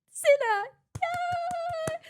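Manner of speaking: high, loud winner reveal with a squeal; re-enacted cheer. Presenter reveals Cinna as winner, excited cheer. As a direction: cry out the name and cheer, very high.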